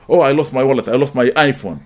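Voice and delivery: ordinary talk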